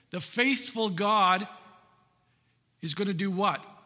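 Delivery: normal talking voice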